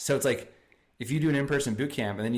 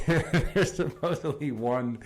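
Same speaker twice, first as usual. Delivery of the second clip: laughing; 5 semitones higher, slower than usual. Real, not acted. Laughs recalling embarrassing video.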